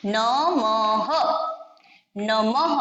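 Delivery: नमः drawn out slowly with final h; very slow नमः with visarga; slow demonstration. Shown as a demonstration. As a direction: stretch नमः carefully. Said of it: "उसी तरह".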